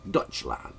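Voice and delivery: Deutschland said low and hushed as DOYTCH-lahnt; German pronunciation. Shown as a demonstration. Showing listeners the German word, Deutschland as DOYTCH-lahnt. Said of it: "German pronunciation for that".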